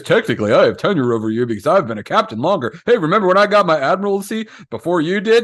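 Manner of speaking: mocking imitation of a smug rival rubbing it in; gloating rival. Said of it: "he rubs it in my face".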